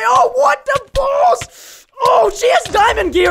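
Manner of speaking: very high, loud panicked yell; startled shout. Real, not acted. Ambushed gamer shouts at a diamond-armored attacker. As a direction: shriek as two enemies charge.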